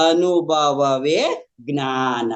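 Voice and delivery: measured, lingering delivery of a teaching; slow, drawn-out maxim. Retold or imitated. Quoting the guru's teaching to listeners, slowly drawn out. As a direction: stretch every syllable very slowly.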